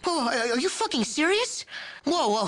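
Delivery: high-pitched stunned disbelief; can't believe what he's hearing; incredulous. Acted. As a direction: squeak it out in outrage. Outraged at being left behind.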